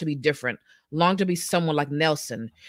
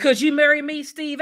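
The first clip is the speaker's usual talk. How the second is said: put-on high, hammy Southern belle accent; 11 semitones higher, 6 dB louder than usual. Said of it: "which was a terrible Southern accent".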